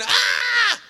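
high, held imitation of a screaming baby; piercingly pitched wail, playfully done; baby's mock scream. Preacher imitating the lady's baby, screaming. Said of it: "her baby's screaming".

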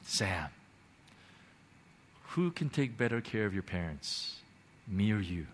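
very soft, low and tender; hushed, low divine reassurance; gentle voice from heaven. Retold or imitated. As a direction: ask it gently, with long pauses. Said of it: "this gentle voice from heaven".

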